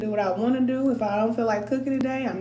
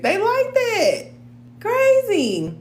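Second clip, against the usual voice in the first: high mocking voice; 10 semitones higher, 4 dB louder, much slower than usual. Mocking son's attitude.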